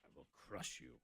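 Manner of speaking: cold rasping evil emperor. Pretend. Mock threat to caller.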